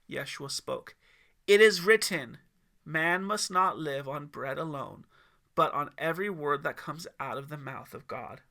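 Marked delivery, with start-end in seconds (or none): 1.5-8.4 loud, firm proclamation; proclaiming scripture. A narrator's reading. Yeshua answering the devil with scripture, loud. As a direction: declare the scripture with authority.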